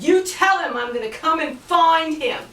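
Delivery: loud, high booming threat; giant threat. Acted. Scottish giant warning Finn he will come, loud threat. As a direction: bellow it at him.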